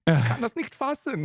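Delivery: angry German demo. Shown as a demonstration. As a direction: demonstrate mild German indignation. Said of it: "you gotta work on your anger level".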